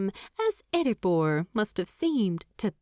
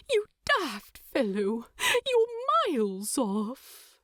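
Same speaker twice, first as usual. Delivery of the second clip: lightly voiced hobbit, fond and teary; teary fond scolding; 6 semitones higher than usual. A narrator's reading. Bilbo teasing Thorin tearfully, fond scolding. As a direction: scold him tenderly through tears. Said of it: "his eyes wet".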